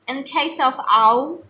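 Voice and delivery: ordinary talk, nothing marked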